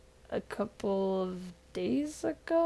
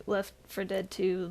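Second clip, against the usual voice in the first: ordinary talk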